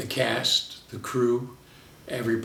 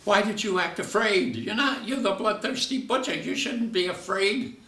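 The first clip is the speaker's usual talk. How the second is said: higher-voiced complaining director; exasperated director; 10 semitones higher than usual. Director telling John he played it wrong, higher exasperated voice. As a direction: scold the actor for getting it wrong. Director scolding actor on set.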